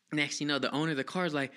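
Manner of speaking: ordinary delivery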